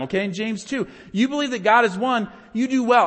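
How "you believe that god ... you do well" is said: higher, louder sarcastic reading; mock the believers with open sarcasm; sarcastic "You do well" pitched up and loud. Shown as a demonstration. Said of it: "This is sarcasm".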